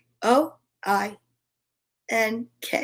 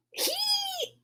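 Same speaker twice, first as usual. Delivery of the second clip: very high, soft hee; tiny high animal hee; donkey hee; 12 dB quieter than usual. Acted. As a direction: squeak it like a braying donkey. Animal laughing in story.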